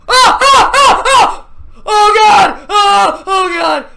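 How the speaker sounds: startled panic screams; panicked screaming. Real, not acted. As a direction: scream in fright at the monster. Sudden giant creature appears.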